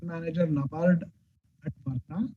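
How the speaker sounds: very soft, fading away; barely audible. Real, not acted. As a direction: voice dropping nearly to nothing. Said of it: "your voice is very low".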